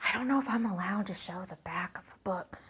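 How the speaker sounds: a real whisper, sharing a secret; whispered aside. Real, not acted. Host to viewers, unsure if allowed, whispering. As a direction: breathe it like you shouldn't be saying it. Sneaky aside about showing book.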